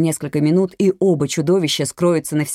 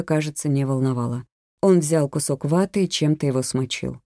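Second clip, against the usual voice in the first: ordinary delivery, nothing marked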